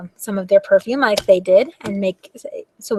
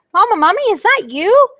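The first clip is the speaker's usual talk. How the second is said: put-on higher, louder little child call; 11 semitones higher, 7 dB louder than usual. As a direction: call out like a lost little kid. Baby mummy calls out.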